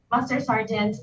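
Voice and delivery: normal talking voice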